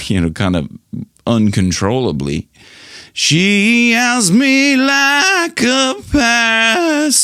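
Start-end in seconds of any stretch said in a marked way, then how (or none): 3.1-7.2 high, slow, overdone singing voice; over-exaggerated impression of the singer; exaggerated vocal demo. Shown as a demonstration. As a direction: ham it up to show the style. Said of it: "I'm over-exaggerating that".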